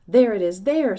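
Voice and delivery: loud pointing shout. A narrator's reading. Someone in the crowd, shouting and pointing. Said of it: "someone else shouted".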